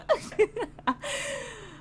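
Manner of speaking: high-pitched delighted giggle. Real, not acted. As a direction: giggle at the cute guy. Giggling over cute guy.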